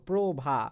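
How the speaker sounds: প্রভা said as "probha", with an o after the র ফলা; pronunciation demo. Shown as a demonstration. Teacher shows students প্রভা spoken with the o sound. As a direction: voice প্রভা with a clear o after প্র. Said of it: "উচ্চারণ করার সময় পয়র ফলা ওকার হয়ে যাচ্ছে".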